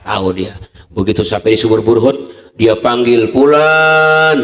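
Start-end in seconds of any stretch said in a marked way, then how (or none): 3.3-4.4 calling out. Acted. Calling into the haunted well.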